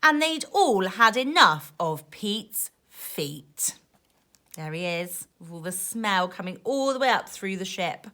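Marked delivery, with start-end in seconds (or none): none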